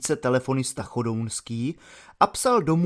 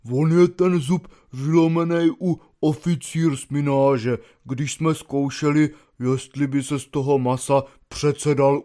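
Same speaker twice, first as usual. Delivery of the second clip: stammering soldier. Acted. Baloun reporting his broken tooth to the lieutenant, stammering. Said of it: "zajíkaje se".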